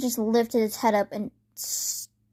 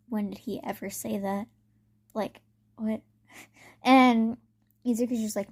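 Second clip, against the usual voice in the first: normal talking voice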